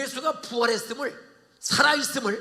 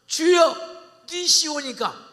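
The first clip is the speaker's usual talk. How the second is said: loud, high, slow trembling address to the Lord; awed reply; 8 semitones higher, 11 dB louder, much slower than usual. Acted. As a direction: answer the unseen voice, stunned. Saul answers the voice.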